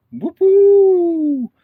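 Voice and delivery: high, loud cheer; excited whoop. Real, not acted. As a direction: whoop it out with glee. Cheering while cooking.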